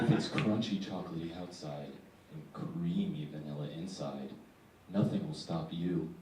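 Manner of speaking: low, hushed sultry ad read; slinky, low-pitched sales pitch; mock sexy ad voice. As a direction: imitate a seductive candy commercial. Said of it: "with this, like, sexy voice".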